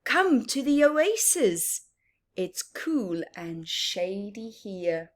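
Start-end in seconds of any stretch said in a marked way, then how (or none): none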